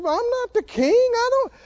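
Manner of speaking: high whimpering mock protest; hammed-up whining underling; whiny staff. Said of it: "And they said".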